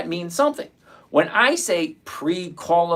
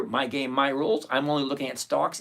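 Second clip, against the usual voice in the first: normal talking voice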